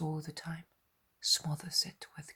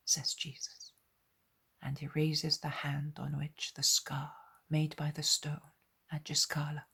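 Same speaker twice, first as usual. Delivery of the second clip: ordinary delivery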